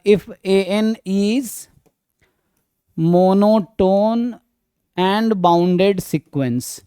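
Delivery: very slow, stretched words with pauses; slow dictation. Real, not acted. Teacher writing the textbook version for students, slow dictation. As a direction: spell it out slowly as you write.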